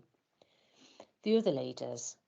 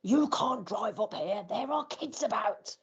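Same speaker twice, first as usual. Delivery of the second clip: slow, higher mock shout at a driver; imitating an angry local's raised warning; retold shout; 6 semitones higher, slower than usual. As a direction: play the cross man waving you off, slow and raised. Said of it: "waving his arms and shouting at me".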